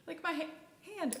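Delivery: words broken by a hiccup. Real, not acted. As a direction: speak through the hiccups. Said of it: "Oh, I have the hiccups".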